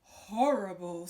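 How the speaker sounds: loud, slow growling wolf; loud slow wolf disgust. Acted. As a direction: spit it out in disgust. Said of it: "said the wolf".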